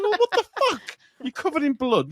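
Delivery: high, loud shocked cry. Retold or imitated. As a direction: shriek it in disbelief. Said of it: "You're like".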